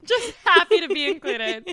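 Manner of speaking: echoing the line through a laugh. Real, not acted. Host laughing as she repeats her cohost. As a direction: giggle while repeating it.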